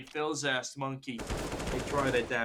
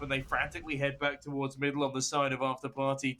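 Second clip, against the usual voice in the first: ordinary delivery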